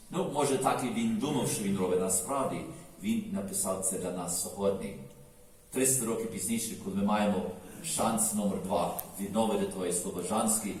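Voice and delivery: ordinary talk, nothing marked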